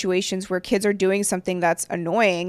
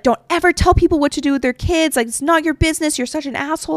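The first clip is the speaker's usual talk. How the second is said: higher, furious rant at a stranger; angry outburst; 6 semitones higher than usual. Retold or imitated. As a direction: tell him off in a sharp, raised voice. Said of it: "she f-, lost it at him".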